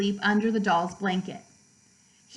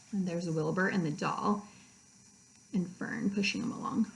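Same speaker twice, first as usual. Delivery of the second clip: softer, almost whispered, pointing at the picture; hushed aside; 5 semitones lower, 9 dB quieter than usual. Real, not acted. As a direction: drop to a near whisper to show the page. Aside while showing book picture.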